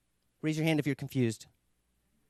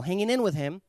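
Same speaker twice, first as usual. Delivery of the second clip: ordinary delivery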